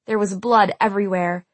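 usual speaking voice

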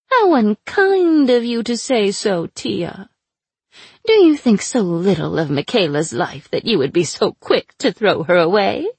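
sly, mocking captive witch; taunting sorceress voice. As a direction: purr it out like a cruel captor. Aina taunting.

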